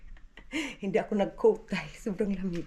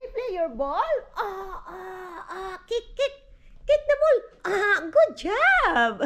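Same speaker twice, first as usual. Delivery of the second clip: high, bright encouragement to a little one; 7 semitones higher, slower than usual. Real, not acted. Mother cheering toddler playing ball.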